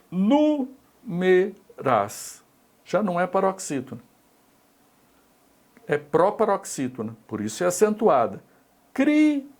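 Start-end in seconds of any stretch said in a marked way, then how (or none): 0.1-2.4 slow, drawn-out example word. Shown as a demonstration. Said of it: "teacher rereads example word to check it". 8.9-9.5 Críticas jumps high and punches the first syllable; high, stressed example word. Shown as a demonstration. Teacher calling out Críticas high to check its stress. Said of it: "teacher rereads example word to check it".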